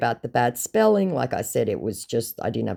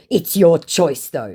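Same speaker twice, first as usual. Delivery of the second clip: mock scammer; 6 semitones higher, 8 dB louder, slower than usual. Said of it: "he said".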